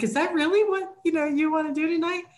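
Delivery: high, fast, mildly nonplussed husband; a lightly voiced unbothered husband asking; husband asking his wife a calm question, mildly nonplussed. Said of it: "kind of a nonplussed".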